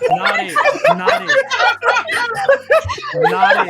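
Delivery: very high, excited "not it"; high squeaky call. Real, not acted. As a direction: squeal it out playfully.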